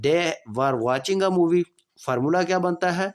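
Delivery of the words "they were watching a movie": slow, clear dictation pace; slow classroom example sentence; slow example read. Shown as a demonstration. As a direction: spell it out slowly for students. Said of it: "teacher reading example sentence".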